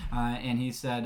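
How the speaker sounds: ordinary talk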